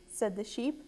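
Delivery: ordinary delivery, nothing marked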